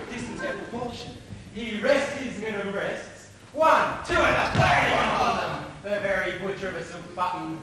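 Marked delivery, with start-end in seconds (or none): 3.5-5.7 loud, high count on each thrust; shouted fencing count. Acted. Mercutio mimics Tybalt's fencing for his friend, shouting. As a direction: shout it out like sword strokes.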